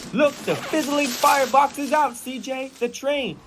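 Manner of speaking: mock yell. Big Smoke warning CJ about the oncoming train, mock yell. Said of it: "he yelled".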